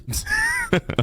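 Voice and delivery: short squeaky laugh; high giggle. Real, not acted. Creator laughing at his find. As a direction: giggle up high at your own discovery.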